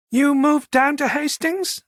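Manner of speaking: slow, high, tentative question ending on "Hastings"; half stated, half asked. A narrator's reading. Manager guessing he moved to "Hastings", half stating, half asking. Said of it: "she half stated, half asked".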